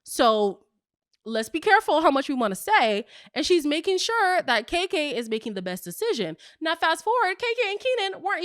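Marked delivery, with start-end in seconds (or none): 0.0-3.0 high, sassy mock scolding. Speaker scolding Mike on the host's behalf, sassy. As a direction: put Mike in his place, sassy and high. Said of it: "And I was like".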